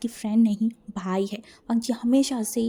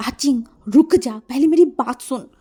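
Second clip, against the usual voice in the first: loud, raised-pitch command to halt; urgent shout to stop; 3 semitones higher, 8 dB louder than usual. Acted. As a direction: call out forcefully to make them listen. Urgently stopping an angry fighter.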